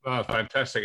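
ordinary talk, nothing marked

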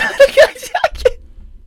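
high, bursting giggle at herself; embarrassed laugh. Real, not acted. To her listeners after rambling, a high embarrassed giggle.

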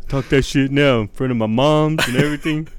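jokey tough-talk, half laughing; mock threat. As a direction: imitate a big guy picking a fight. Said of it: "He'll be like".

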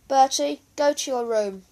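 put-on stern order to a child. A narrator's reading. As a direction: send the boy off firmly. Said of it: "ordered Mum".

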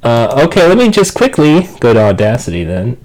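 fuzz distortion on the mic; clipping, overloaded microphone; distorted mic. Real, not acted. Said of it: "You sound really distorted".

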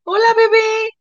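put-on very high, sing-song baby talk; sweet high baby-talk hello; cooing to a baby. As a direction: coo it like greeting a baby.

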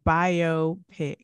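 biopic slowly, BYE-oh, pick; explained pronunciation. Shown as a demonstration. Co-host explaining the short form, BYE-oh pick slowly. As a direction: break biopic into bio and pick. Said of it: "because it's short for".

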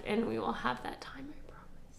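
soft tender whisper, fading away; whispered promise. Acted. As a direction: breathe the promise almost silently. Reading emotional farewell quote.

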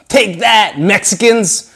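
high, loud taunt; mock battle cry. Throwing pent-up emotions at someone, high mock-triumphant yell. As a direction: call it out as you hurl a fireball.

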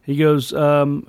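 usual speaking voice

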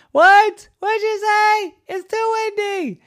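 very high, squealed townsfolk voice; yelling over wind. Acted. Townspeople who can't hear Harry, shrieky calling. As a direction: squeal it out as if deafened by a gale. Townspeople shouting through the gale.

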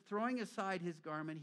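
usual speaking voice

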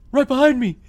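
loud, high hammed-up alarm; mock panicked yell. Man fleeing cows tells the operator, mock panicked yell. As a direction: yell it like a comic chase victim. Stampede victim answering the operator.

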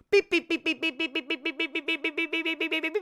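rapid high-pitched beep imitation; imitated alarm beeping. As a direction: beep like a panicked alarm going off. Imitates an alarm for his anxiety.

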